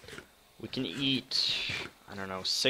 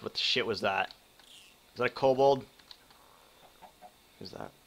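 usual speaking voice

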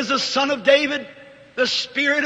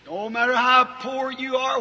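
normal talking voice

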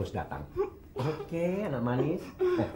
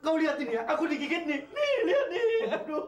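high-pitched wail of a bitten boss; pained complaint; 10 semitones higher than usual. Acted. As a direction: complain in pain, voice pushed way up. Boss shows his dog bite.

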